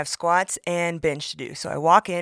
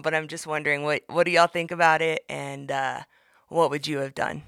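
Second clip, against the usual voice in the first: usual speaking voice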